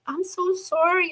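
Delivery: put-on small, high-pitched child apology; imitated apologetic grandchild. Granddaughter fearing trouble, high apologetic imitation. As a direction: mimic a little girl pleading sorry. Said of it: "she thought she was gonna get in trouble".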